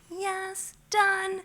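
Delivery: high, pleased little cheer; triumphant yes. Retold or imitated. To herself after packing the fridge, a high pleased cheer. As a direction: squeal it out, job done. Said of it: "I'm like".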